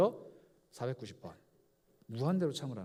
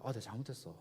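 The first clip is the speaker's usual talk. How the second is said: soft, lower mock-apologetic plea; mock apology; 6 semitones lower, 10 dB quieter, faster than usual. Repeat offender begging forgiveness, a soft mock plea. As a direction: play the sheepish repeat offender.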